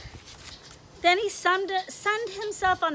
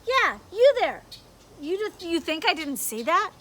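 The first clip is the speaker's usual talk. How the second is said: loud, stern scolding voice; a bossy inner voice catching him out; stern conscience; 3 semitones higher, 7 dB louder than usual. Acted.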